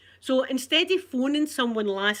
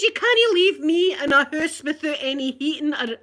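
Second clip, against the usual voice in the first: louder, higher indignant retort; wound-up protest; 4 semitones higher, 7 dB louder than usual. Retold or imitated. Customer threatening engineer with the newspapers, wound-up protest. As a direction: re-enact a heated complaint.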